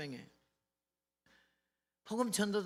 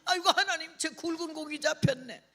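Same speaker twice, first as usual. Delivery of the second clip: high, elated exclamation to God; retold delight; 7 semitones higher than usual. Preacher thanking God at sight of two old men, high delighted cry. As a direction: exclaim joyfully, pitched right up. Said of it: "얼마나 반갑던지".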